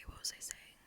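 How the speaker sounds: normal talking voice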